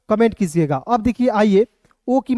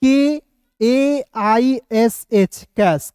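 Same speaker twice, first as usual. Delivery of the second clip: letters spelled out one by one, very slowly; slow spelling; much slower than usual. Shown as a demonstration. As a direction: spell each letter with long gaps. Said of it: "कैश को दो तरीके से आप लिख सकते हो".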